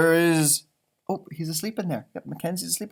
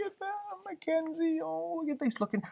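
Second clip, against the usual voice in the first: soft cooing; 10 semitones higher, 10 dB quieter than usual. Acted. Reacting softly to sad picture.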